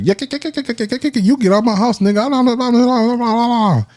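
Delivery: shrill-free but high mock yelling of a wife; mock angry wife. Most wives throwing the cheating man out, in a high mock rant. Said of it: "be like".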